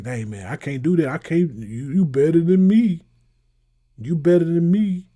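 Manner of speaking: loud, exaggerated please-share plea; hammed-up pleading cry; mock pleading. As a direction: beg loudly like a freeloading friend. Acted pleading, self-deprecating quote.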